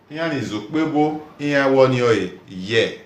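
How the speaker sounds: normal talking voice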